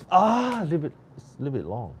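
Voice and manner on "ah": sudden high shout of excitement; excited shout. Real, not acted. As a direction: yelp at the near goal. Chance missed in match.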